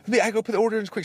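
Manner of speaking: high-pitched flustered server; imitating freaking out. Server warns customer about cooking time, high and freaking out. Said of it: "She's, like, freaking out".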